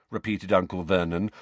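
usual speaking voice

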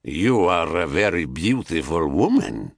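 put-on low seducer in a Thracian accent; deep, forceful stranger with Thracian accent; thick Thracian accent. A narrator's reading. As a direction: put on a heavy Thracian accent, pitch dropped. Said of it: "in a thick Thracian accent".

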